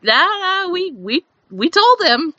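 playfully defensive high protest; high mock protest. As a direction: squeal out an innocent denial. Friends insisting they warned him.

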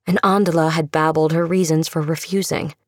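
usual speaking voice

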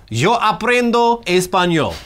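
slow, careful Spanish; Spanish line. Shown as a demonstration. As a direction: say it like a proud learner. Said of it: "I'm learning Spanish".

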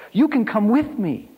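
put-on high child's hopeful plea; eager, high-pitched invitation; desperate hope. A narrator's reading. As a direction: plead in a high little-boy voice, seizing a last hope. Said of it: "allowing himself a few seconds of hope".

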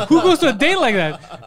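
high, loud incredulous question; mock freak-out. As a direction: imitate an appalled viewer, amused underneath. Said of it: "he's freaking out".

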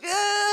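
put-on high child squeal; kid's squeal. Kid on the phone, squealing high. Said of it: "I'm like".

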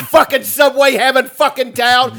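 high, loud jeering tirade; mock the town's fans; imitated rant. Comic insults Philly fans, high loud imitated rant. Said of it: "His rant at the city of Philly".

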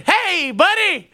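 husband sarcastically greets the installer who finally showed up; mock-friendly greeting. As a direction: greet him sweetly, dripping with sarcasm. Confronting the late installer.

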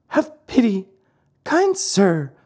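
high-pitched pitiful begging; piteous, high little voice. A narrator's reading. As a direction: plead like a small puppet. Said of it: "asked in a pitiful voice".

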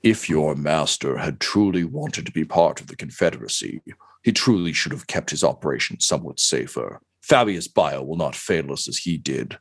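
low, measured villain speech; menacing inquisitor. Acted. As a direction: pronounce a death sentence in a low voice. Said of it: "and says".

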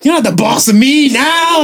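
put-on high defiant kid voice; defiant teen. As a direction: talk back like a teenager. Retorting to mom as kid.

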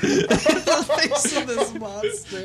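laughing. Real, not acted. Repeats line while laughing.

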